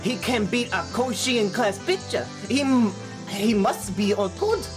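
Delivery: usual speaking voice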